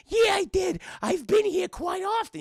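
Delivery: put-on very high, loud old man squeal; Whitey boasting to Adam; squeaky proud reply.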